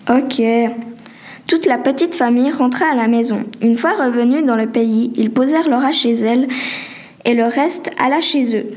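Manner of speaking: usual speaking voice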